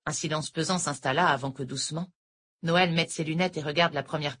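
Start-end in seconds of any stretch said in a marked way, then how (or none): none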